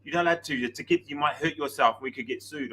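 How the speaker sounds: hushed protest in a mock Mexican accent; Mexican Spanish accent. Wheelchair pusher warning passenger off, in a soft imitated Mexican accent. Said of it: "talking to me in her Mexican, Spanish accent".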